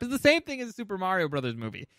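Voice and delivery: ordinary talk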